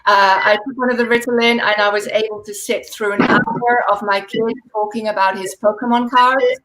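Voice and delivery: ordinary talk, nothing marked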